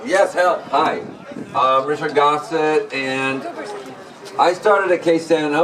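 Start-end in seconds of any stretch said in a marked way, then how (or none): none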